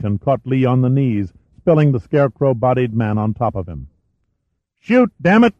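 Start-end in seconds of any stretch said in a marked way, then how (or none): 4.8-5.5 high-pitched, forceful shouted command; urgent yell. A narrator's reading. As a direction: yell it desperately at your wife. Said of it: "Lee yelled to his wife".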